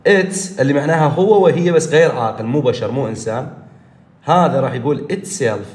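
ordinary talk, nothing marked

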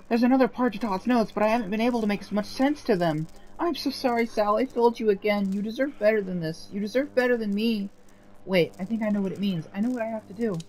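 put-on remorseful young hero. Voices Sal apologizing to ghost.

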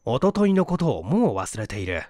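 plain reading voice

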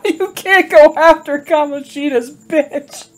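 high-pitched, voice breaking up with laughter; real laughter bubbling through the words; amused, laughing. Real, not acted. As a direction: squeal it through helpless giggles. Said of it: "Why is that funny to me?".

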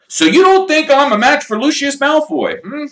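high, loud mock-offended question; indignantly. Mr Weasley answering his wife's warning, indignant and loud. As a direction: bristle at the slight. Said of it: "said Mister Weasley indignantly".

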